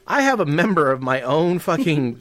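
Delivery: ordinary talk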